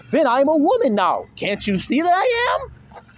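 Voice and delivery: put-on high voice, stretched at the end; mock pleading protest. Acting out man claiming womanhood.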